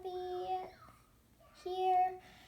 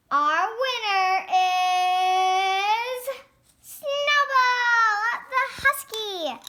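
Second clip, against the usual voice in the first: slow, loud, drawn-out announcer call; winner announcement; 2 semitones higher, 9 dB louder, much slower than usual. Pretend. Judge announcing the winning husky, slow and loud. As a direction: stretch it out like a show host.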